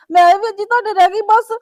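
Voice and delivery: tearful loud complaint; crying out. Acted. Daughter cries to her mother, tearful and loud. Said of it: "ਚੱਲ ਪੁੱਤ ਰੋ ਨਾ".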